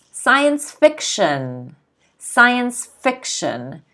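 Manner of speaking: slow Science fiction, FIC in fiction stressed. Shown as a demonstration. Teacher slowly models Science fiction, stressing FIC. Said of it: "Notice the stress is on the fi".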